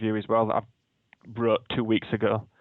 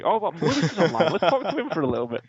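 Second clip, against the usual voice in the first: higher, louder mock surprise; fake surprise; 6 semitones higher, 4 dB louder than usual. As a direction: act casually surprised Lewis is online. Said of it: "I'll be like".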